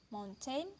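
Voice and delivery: normal talking voice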